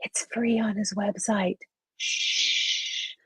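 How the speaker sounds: hushed, conspiratorial whisper. Real, not acted. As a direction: let them in on a secret, then shush. Sharing a secret tip on air.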